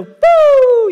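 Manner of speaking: high, loud joyful whoop. Retold or imitated. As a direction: let out a reckless cheer mid-turn. Wild U-turn, cheering.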